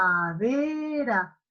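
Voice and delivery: "Heladera" said slowly, split into beats; syllable by syllable. Shown as a demonstration. Teacher to students, slow syllable split. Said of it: "¿Cómo lo separamos en sílabas?".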